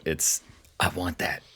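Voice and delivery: usual speaking voice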